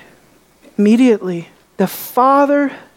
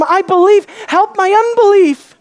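higher, urgent cry of a desperate father; anguished cry; 8 semitones higher than usual. Retold or imitated. Father begging Jesus for his son, urgent higher cry. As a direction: plead it out, pitch lifted. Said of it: "the Father is thinking".

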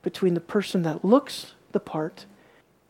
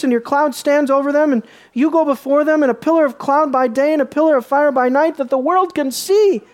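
loud, high pleading declamation; fervent sermon climax; preaching at full force; 9 semitones higher, 10 dB louder than usual. Acted. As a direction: drive Moses' plea home to the congregation. Declaims Moses' plea.

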